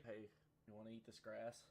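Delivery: a limp, quiet sales pitch; mock vegan pitch. Vegan offering grass to a chicken eater, mock coax. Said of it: "walk up to them and say".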